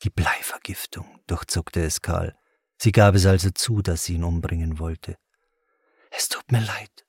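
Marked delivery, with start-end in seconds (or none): 0.0-1.2 hushed, voiceless inner realisation; whispered thought. A narrator's reading. Shocked thought in a character's head. 6.1-7.0 hushed, whispery apology; softly whispered regret; breathed. A narrator's reading. Her breathed apology repeated.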